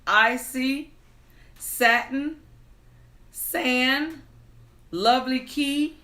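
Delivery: brighter, lifted storytelling voice; animated fairy-tale reading; story narration. A narrator's reading. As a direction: read it like a bedtime tale. Reads fairy tale story time.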